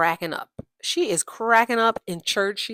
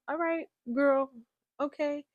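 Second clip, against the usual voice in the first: skeptical indulgence; 7 semitones higher than usual. Retold or imitated. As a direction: humor her with gentle doubt. Doubtful reaction to her claim.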